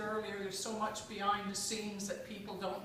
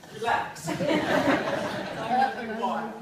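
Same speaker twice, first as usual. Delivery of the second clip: laughing; 3 semitones higher, 8 dB louder than usual. Real, not acted. Laughing through her opening remarks.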